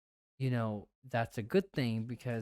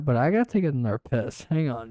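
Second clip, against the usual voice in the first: usual speaking voice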